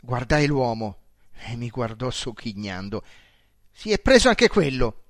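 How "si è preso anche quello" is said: louder, higher outburst; exclaiming. A narrator's reading. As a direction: cry out at the new theft.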